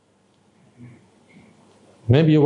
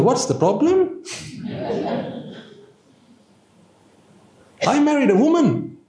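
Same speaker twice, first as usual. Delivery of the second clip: much higher, squeaky disbelieving outburst with a laugh; incredulous husband; 12 semitones higher than usual. Acted. Husband finds his wife changed and squeals high in laughing disbelief. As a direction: play the baffled husband, voice shooting up in disbelief.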